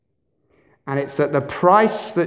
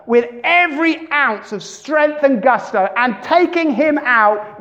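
rising higher and louder into the hit; hyped-up tackle; 8 semitones higher, 3 dB louder than usual. Retold or imitated. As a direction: build the excitement of a big tackle. Mentor hyping a crunching rugby tackle.

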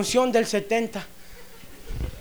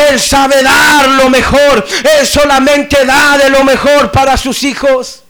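impassioned preacher swelling to a shout; full-force preaching; 2 semitones higher, 7 dB louder than usual. Acted. Preacher telling believers to make room for blessing, building fervent preaching. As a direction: build steadily until you are shouting the promise.

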